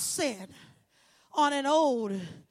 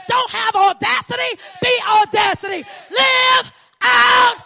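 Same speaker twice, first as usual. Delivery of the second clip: from firm preaching up to a loud final cry; rally the congregation, building to a roar; preaching building to shouts; 4 semitones higher, 7 dB louder than usual. Acted. Sermon climax, building to shouts.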